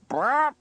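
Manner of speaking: loud rolled brrrr noise; imitated ripping crash. A narrator's reading. Narrator imitates trombone through drum, loud burr. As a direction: make a loud tearing trill.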